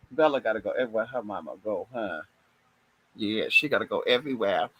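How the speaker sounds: low, soft, quick cooing to the pet. Real, not acted. Cooing to her dog Bella, low soft baby talk.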